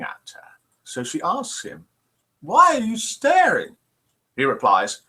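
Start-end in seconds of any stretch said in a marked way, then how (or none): 2.4-3.8 nun's voice. Acted. Nun voiced in joke.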